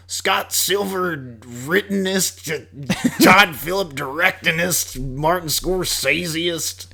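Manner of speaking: high, slow, drawn-out cartoon cowboy twang; Yosemite Sam. Mocking the movie credits in a cartoon cowboy twang. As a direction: ham up a rootin'-tootin' cartoon announcer. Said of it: "That sounded like a Yosemite Sam".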